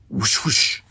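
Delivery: breathy imitated whoosh; torpedo whoosh. A narrator's reading. Narrator imitating torpedoes launching, whooshing. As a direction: make two rushing launch noises.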